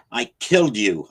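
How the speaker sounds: enraged hero yelling at the shadow; yelled. A narrator's reading. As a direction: hurl it at him. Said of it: "I yelled".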